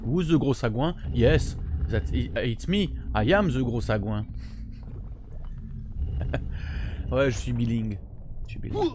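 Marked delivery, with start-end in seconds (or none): none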